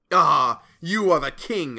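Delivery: loud, high taunting cry; cried out. A narrator's reading. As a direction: burst out in scornful challenge. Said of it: "I cried".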